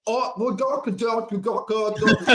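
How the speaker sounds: put-on high-pitched caricature of an uneducated Brit's accent; mock uneducated British accent. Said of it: "uneducated side of it of like".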